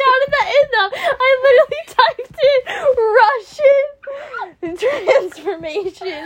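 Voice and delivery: words squeezed out between giggles; real laughing fit. Real, not acted. Laughing at search mistake.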